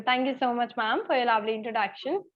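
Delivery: ordinary delivery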